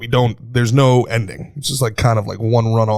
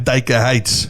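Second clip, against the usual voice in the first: normal talking voice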